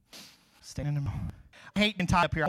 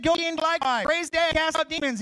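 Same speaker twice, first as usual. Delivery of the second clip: preaching at full force; 9 semitones higher, 5 dB louder than usual. Acted. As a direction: charge them with Jesus' command.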